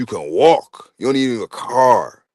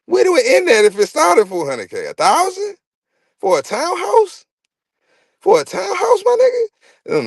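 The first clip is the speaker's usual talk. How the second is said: incredulous; 13 semitones higher than usual. Retold or imitated. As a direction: pitch climbing higher and higher in disbelief. Incredulous at townhouse prices.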